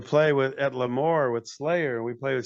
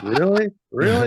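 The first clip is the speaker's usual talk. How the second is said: mock disbelief; 6 semitones higher, 7 dB louder than usual. Disbelief at no-shower rule.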